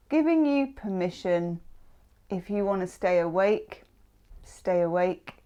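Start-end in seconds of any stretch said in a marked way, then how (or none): none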